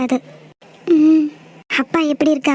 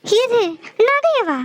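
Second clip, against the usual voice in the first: outraged surprise; 6 semitones higher, much slower than usual. Acted. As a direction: pitch jumps high in disbelief.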